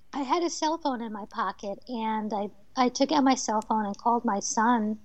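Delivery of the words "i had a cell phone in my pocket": ordinary talk, nothing marked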